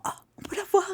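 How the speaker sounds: breathy high gasp of wonder, hushed; a little amazed whisper, as if seeing sparks in the dark; hushed amazement at the sparks; surprised whisper. Retold or imitated. Retold own surprise seeing sparks.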